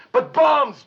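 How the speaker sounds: high, loud exclamation; scandalized outburst. Acted. Scolding Joe for making bombs, scandalized outburst. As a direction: burst out in scandalized protest.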